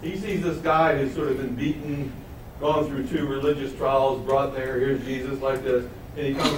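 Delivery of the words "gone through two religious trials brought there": ordinary talk, nothing marked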